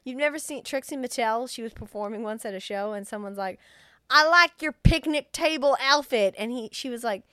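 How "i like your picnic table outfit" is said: loud, pitched-up fan call; voiced audience member. Someone calling to a performer at a show, loud compliment.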